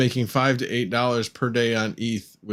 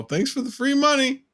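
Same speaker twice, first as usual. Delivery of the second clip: mock gloating; 13 semitones higher than usual. Mock-reading a gloating post.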